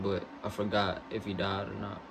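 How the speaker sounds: ordinary talk, nothing marked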